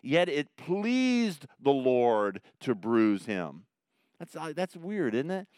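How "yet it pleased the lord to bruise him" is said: scripture reading. Acted. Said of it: "Isaiah said it this way".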